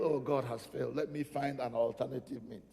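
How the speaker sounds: low, quiet voice of giving up; disheartened believer. Acted. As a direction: resign yourself, dropping low and soft. Voices the disheartened who give up.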